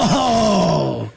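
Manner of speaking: bright, excited loud Oh; loud eager Oh. Shown as a demonstration. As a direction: burst out Oh like big news is coming. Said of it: "like I'm excited to tell you something".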